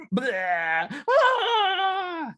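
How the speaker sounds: high, loud wild holler; over-the-top cartoon yelling; cartoon blah and shriek. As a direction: blurt a blah, then a long high scream. Acting troll's noises while dancing.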